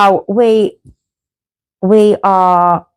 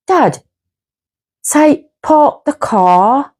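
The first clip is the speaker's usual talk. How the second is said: put-on higher, slow little-kid request; imitated toddler; 4 semitones higher, much slower than usual. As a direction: ask slowly like a small child. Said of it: "he was only about two".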